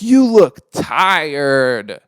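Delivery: very slow, loud mocking drawl; mocking guy. Rude guy commenting on the speaker's face, slow and loud. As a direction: stretch it out like the rude guy did. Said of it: "sandbags me with".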